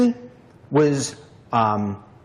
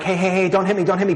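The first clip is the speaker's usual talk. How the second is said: pleading at gunpoint; 5 semitones higher, much faster than usual. Shown as a demonstration. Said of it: "and I'm like".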